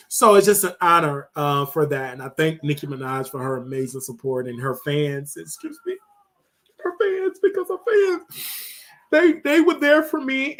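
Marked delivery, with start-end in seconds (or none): 5.4-10.5 high, breaking voice on the verge of tears; real crying, voice cracking high; starting to cry. Real, not acted. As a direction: let the voice crack as the tears come. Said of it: "I'm just getting emotional".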